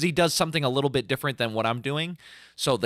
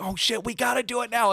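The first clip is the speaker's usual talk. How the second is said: high, excited outburst; the crowd suddenly pumped; mock hyped crowd; 11 semitones higher than usual. Imitating fans getting ready to mosh, excited. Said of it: "they're gonna be like".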